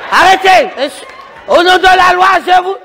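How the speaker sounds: mock-official shout in the name of the law; shouted police order. Plays officer ordering a stop.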